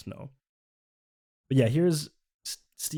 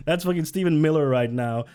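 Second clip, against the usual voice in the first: ordinary talk, nothing marked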